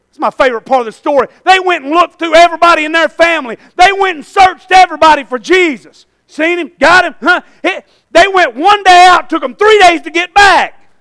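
high, loud storytelling sermon; full-force preaching. Real, not acted.

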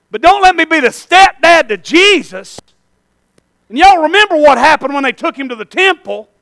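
building to a high, loud shout; full-force preaching. Real, not acted.

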